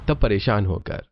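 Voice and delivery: ordinary delivery, nothing marked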